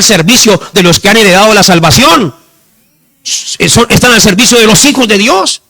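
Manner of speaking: loud, high scripture proclamation; full-force preaching. Real, not acted. Preacher declaring angels' protection to the church, full-force preaching. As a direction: proclaim the promise with fervor.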